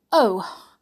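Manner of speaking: high, loud, offhand greeting; airy. Acted. Sooty greets the butler airily, high and casual. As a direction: toss the hello off airily. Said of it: "said Sooty airily".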